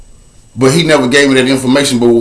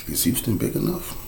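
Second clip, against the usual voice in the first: much softer, lower ominous aside; hushed refrain; 6 semitones lower, 15 dB quieter than usual. Acted. Repeating his refrain to the audience, hushed and low. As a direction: drop it to a low hush.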